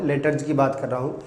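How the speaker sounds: ordinary talk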